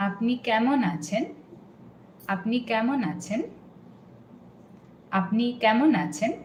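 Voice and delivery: fast, run-together question; natural flowing speed. Shown as a demonstration. Teacher showing the natural flow to learner. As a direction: say it in one quick flow. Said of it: "it goes like".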